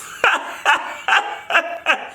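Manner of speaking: airy unvoiced laugh; breathy laugh. Real, not acted. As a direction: laugh it off, embarrassed. Laughing at the accent question.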